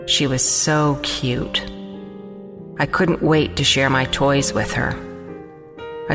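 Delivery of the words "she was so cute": plain reading voice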